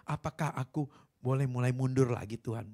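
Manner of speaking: ordinary delivery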